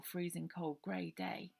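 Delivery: ordinary delivery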